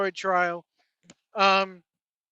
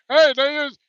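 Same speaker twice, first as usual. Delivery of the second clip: louder, mushy mumbled impression; mumbling Tony Atlas impression; 4 semitones higher, 7 dB louder than usual. Host mocks Tony Atlas talking as if he has marbles in his mouth, louder and mumbled. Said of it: "marbles in his country ass".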